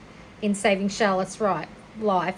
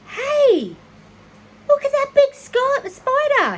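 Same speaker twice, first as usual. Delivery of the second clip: put-on high, loud excited boy voice; a kid thrilled at a big spider; boy's excited call; 8 dB louder, slower than usual. Avery calling out to Fern about the spider, high and excited.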